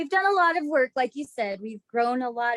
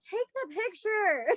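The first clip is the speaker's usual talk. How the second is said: high, slow, drawn-out impatient demand; impatient quote; 9 semitones higher, slower than usual. Retold or imitated. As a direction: drag it out, fed up with waiting. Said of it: "And I'm like".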